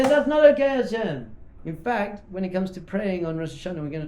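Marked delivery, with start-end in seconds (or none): 0.0-1.3 loud, high outraged protest; screaming at Hashem. Acted. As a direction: cry out in protest to God. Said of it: "You scream at Hashem".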